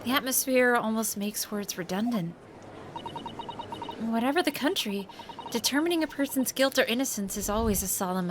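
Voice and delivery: polite, soft game character read; young woman character voice. Acted. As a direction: voice the assistant gently and politely. Reads a game character's lines.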